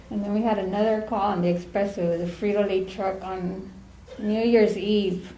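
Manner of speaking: ordinary talk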